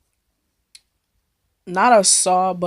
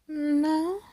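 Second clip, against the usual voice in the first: higher, soft, dismissive no; flat refusal; 7 semitones higher, 8 dB quieter than usual. Retold or imitated. Turning down a guy's proposition, higher and dismissive. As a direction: brush him off.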